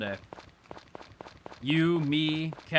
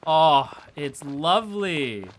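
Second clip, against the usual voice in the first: loud, high rapture; delighted loud gushing; 10 semitones higher, 8 dB louder, slower than usual. Real, not acted. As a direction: gush over it loudly. Gushing over house.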